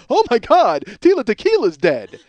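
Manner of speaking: hammed-up horrified fan; mock shock. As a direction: gasp it out like a late-night fan hearing news.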